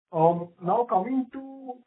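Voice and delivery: muffled speech on a speakerphone; muffled, as if through a covered mic. Real, not acted. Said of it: "slightly muffled now".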